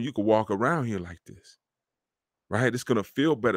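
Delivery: ordinary delivery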